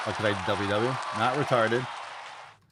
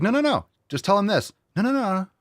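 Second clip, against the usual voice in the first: mock eager correction; 9 semitones higher, 3 dB louder than usual. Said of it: "You're like".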